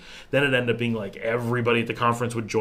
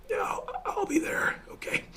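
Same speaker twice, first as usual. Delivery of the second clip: voiceless whisper; 5 dB quieter than usual. Retold or imitated. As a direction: agree with almost no voice. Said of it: "talking like this".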